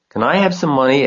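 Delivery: put-on high eager girl asking; little girl voice. A narrator's reading. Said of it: "asked Fern".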